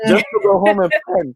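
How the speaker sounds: squeaky laughing delivery. Real, not acted. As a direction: voice jumps high through a laugh. Laughing while telling story.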